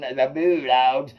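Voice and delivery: slow, lightly voiced old man; Larry McKinney drawl. Quoting an old neighbor, slow and drawn out. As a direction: stretch it out like an old Georgia fan. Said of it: "as old Larry McKinney would say".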